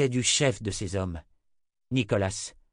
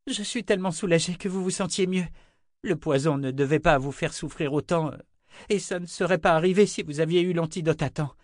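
higher-pitched, relieved then self-excusing; eager young man, apologetic; 7 semitones higher than usual. Acted. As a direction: plead your case in a young man's high voice. Figure of young Owen.

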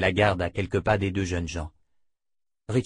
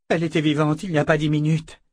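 shocked protest; 6 semitones higher, 5 dB louder, faster than usual. Acted.

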